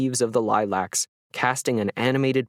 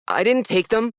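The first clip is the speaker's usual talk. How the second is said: high, panicked denial, pitched up and a little louder; frightened suspect blurting a denial; bursting out; 11 semitones higher, 4 dB louder than usual. A narrator's reading. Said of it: "Melissa burst out".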